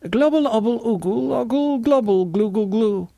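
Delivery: high gobbling gibberish; high-pitched gurgled babble; nonsense grunting. A narrator's reading. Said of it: "made some grunts and said".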